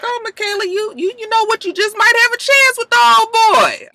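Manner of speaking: very high, sing-song teasing; high teasing gossip voice; teasing falsetto. Retold or imitated. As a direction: coo it like a gossiping friend. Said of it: "So I was like".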